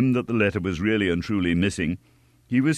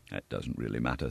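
softer, lower musing; inner thought; 8 semitones lower, 11 dB quieter than usual. A narrator's reading. Innkeeper about the lost customers, quiet thought. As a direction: think it to yourself. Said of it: "thought the innkeeper".

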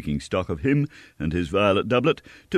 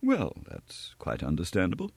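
much softer, cool and unbothered; very quiet; 8 dB quieter than usual. A narrator's reading. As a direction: quietly dismiss him. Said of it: "more quietly than ever".

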